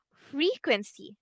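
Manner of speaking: slow "Frequency", leaning on FRE; stress on first syllable. Shown as a demonstration. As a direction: say "Frequency" slowly for a student to repeat. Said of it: "have the stress on the first syllable".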